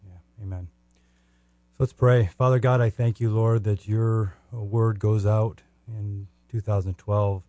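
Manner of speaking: ordinary talk, nothing marked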